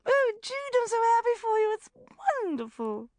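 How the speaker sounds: high, loud gushing delight. A narrator's reading. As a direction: gush over her like an old friend. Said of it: "she gushed".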